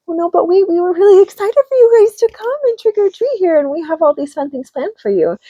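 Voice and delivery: high, eager witch voice; a friendly witch pleading sweetly. Retold or imitated. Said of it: "And the witch says".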